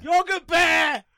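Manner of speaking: stretched, loud high shout. Real, not acted. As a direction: belt the word out slowly. Shouting the joke word.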